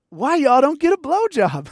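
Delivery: put-on woman's voice. Woman propositioning men at van window, imitated pitch.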